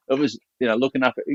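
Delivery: ordinary talk, nothing marked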